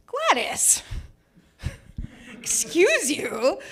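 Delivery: high-pitched, affronted comeback, drawn out at the end; indignant retort. Retold or imitated. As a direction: call her out, high and offended. Snapping back at a passing heckler.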